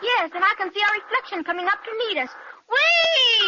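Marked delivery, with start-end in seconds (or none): 2.7-3.5 loud, high whoop on the way down; thrilled shout. Acted. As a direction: yell with the rush of the drop. Children's plane drops into valley.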